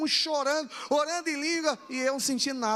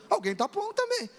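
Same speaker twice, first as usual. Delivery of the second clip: puzzled; 10 semitones higher than usual. Retold or imitated. Voices own puzzled thought.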